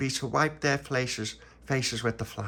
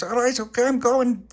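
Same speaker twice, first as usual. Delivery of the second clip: very high, squeaky compliance; reluctant prisoner; 8 dB louder than usual. Acted. Prisoner going into the pit, squeaky high. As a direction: hurry to obey.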